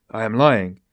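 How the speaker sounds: mildly incensed, with an ironic undertone; mock incensed. A narrator's reading. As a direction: play the offence with a smile. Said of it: "apparently incensed".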